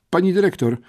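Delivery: high, anxious pupil's plea; stammering plea. A narrator's reading. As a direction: blurt a timid request to the headmistress, pitched high. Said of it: "wybełkotał". (błagalny, wysoki bełkot ucznia)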